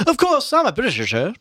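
high English gentleman; Haynes voice. A narrator's reading. Reader voicing Haynes as an Englishman, high. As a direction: lift into the Englishman. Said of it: "Haynes' voice".

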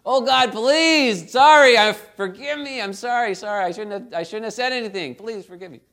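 Aaron pleading with God after Miriam turns leprous, mock-frightened; frightened begging. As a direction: beg God for mercy, hammed-up fear. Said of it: "he's afraid what's gonna happen to him next".

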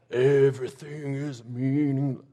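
slow, drawn-out emo whine; emo singer. Shown as a demonstration. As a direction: intone it like a two-thousands emo act. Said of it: "two thousand emo".

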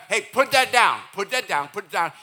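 loud scolding, easing after the first shout; parent yelling. Acted. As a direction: bark repeated orders at a child. Acted parent yelling repeated command.

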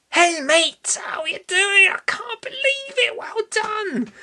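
high-pitched gushing congratulations in a put-on voice; exaggerated high voice, cheering on a mate; put-on squeaky well-wisher; Biggsy impression. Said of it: "I can do his, a little bit of do his, his, um, how he speaks".

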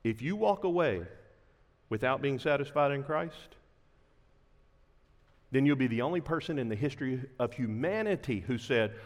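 usual speaking voice

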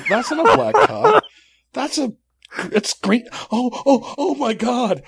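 laughing and appalled; laughing disbelief. Real, not acted. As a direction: laugh through the telling, pitch climbing. Disgusted discovery, laughing.

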